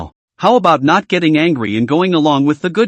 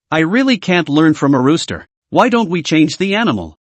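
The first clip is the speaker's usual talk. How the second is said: ordinary delivery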